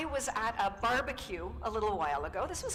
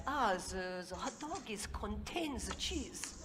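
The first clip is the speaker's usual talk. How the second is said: lightly put-on French accent, halting; a French cook fumbling his English, a hint of French; 5 dB quieter than usual. French engineer explaining the cheese to Korean guy, light French accent. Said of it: "French guy says".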